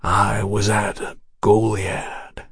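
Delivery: slow, soft, dry near-whisper. A narrator's reading. Texian survivor naming the massacre, slow dry whisper. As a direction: let it drag out, hushed. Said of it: "the voice gone to a dry whisper".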